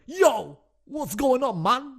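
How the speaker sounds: high-pitched mock gangster greeting; put-on street tough voice. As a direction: hail them like a gangster. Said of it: "being super gangster".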